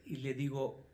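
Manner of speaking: ordinary talk, nothing marked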